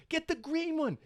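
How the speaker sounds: put-on high excited child voice; kid's voice. Son begging dad for the green cat, high childlike voice. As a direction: imitate the kid begging for the green one. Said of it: "He's like".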